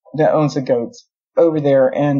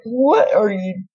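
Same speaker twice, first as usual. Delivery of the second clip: high, slow mock-incredulous question; acted-out astonishment at a silly creature; baffled disbelief; 8 semitones higher, much slower than usual. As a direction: stretch it out and send the pitch way up. Acts out her baffled reaction.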